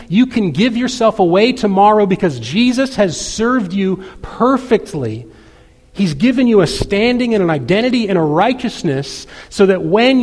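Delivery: impassioned preaching. Real, not acted. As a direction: preach it to the congregation with building urgency. Preaches the gospel application emphatically.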